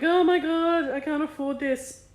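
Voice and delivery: high, loud panicky gasp of worry; panicked thought. Retold or imitated. Anxious inner voice fearing the cost, high panic. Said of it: "I'm like".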